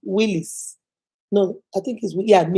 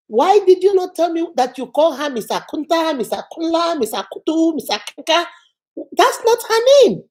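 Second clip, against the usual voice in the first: higher-pitched mock scolding, peaking on the last sentence; indignant director imitated; 5 semitones higher than usual. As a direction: reel off the wrong names as an accusation. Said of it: "My director said".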